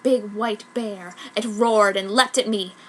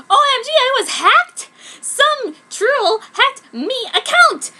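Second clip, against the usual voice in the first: much higher and louder, slow, laughing and sobbing fury; outraged fangirl rant; 9 semitones higher, 8 dB louder, slower than usual. Acted. Author screaming at the troll who hacked her account. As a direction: rage at the hacker in a melodramatic tantrum.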